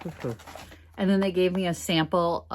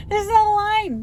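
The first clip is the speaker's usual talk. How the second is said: excited relief; slower than usual. Retold or imitated.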